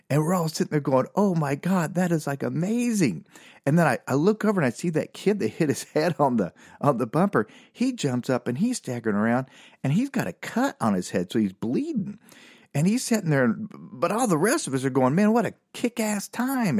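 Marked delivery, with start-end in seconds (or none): 1.2-3.2 raised-pitch mock amazement; amazed onlookers. Storyteller as the crowd after the drive-by, amazed and higher. 15.1-16.8 high, excited retelling; thrilled crowd. Retold or imitated. Storyteller as the fans after the show, high and thrilled.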